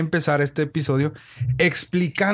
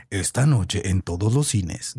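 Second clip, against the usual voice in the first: deep slow trailer announcer; low, slow epic voiceover; movie trailer voice; 5 semitones lower, slower than usual. Shown as a demonstration. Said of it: "Así como trailer de película".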